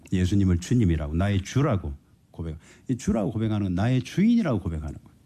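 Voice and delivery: ordinary delivery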